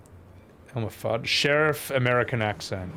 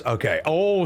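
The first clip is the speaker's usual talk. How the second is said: ordinary talk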